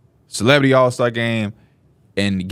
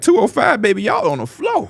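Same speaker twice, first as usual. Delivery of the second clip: much higher sweet usher voice; usher lady; 8 semitones higher than usual. Retold or imitated. The usher lady telling them their seats, high and sweet. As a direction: sing it like a cheerful usher.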